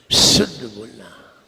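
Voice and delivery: शुद्ध with a long, forceful "sh" hiss; whistling sibilant. Shown as a demonstration. Teacher repeats it for the class, whistling शुद्ध. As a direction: stretch the whistling start of शुद्ध, slowly. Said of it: "आवाज देखिए".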